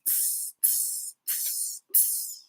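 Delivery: soft unvoiced tss sounds; breathy tss flicker noise; imitated flicker. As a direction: mimic the lights sparkling. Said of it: "and it goes".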